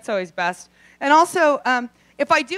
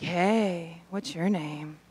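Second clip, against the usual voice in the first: hushed drugged mumble; 7 semitones lower, 8 dB quieter than usual. Retold or imitated. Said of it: "be like".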